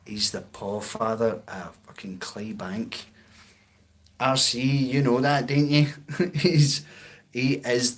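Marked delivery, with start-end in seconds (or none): 0.1-3.1 unvoiced whisper, much softer; whispered aside. Real, not acted. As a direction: lean in and whisper it like a secret. Aside about his dog.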